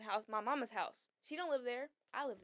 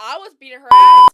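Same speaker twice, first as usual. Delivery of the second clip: loud boast; 6 semitones higher, 28 dB louder than usual. Real, not acted. Retelling the fight.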